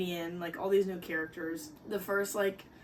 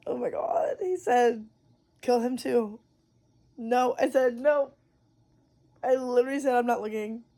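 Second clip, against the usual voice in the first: louder, panicked protest at the book; frightened reader yelling at the story; loud outburst; 3 semitones higher, 9 dB louder than usual. Real, not acted. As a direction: shout your refusal at the page.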